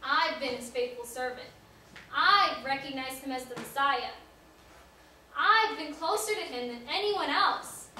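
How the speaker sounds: loud, emphatic declaration of devotion; impassioned protest. Acted. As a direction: protest your love loudly, driving each claim home. Peter protests his lost devotion.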